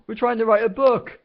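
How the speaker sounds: high, loud, desperate yell. Acted. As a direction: protest at the top of your lungs. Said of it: "yelled Ford".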